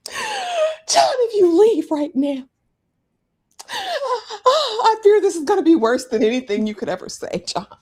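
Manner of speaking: mock pleading wife. Host mocks wife pleading with John, high whine. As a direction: whine his name like a desperate wife. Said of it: "She says".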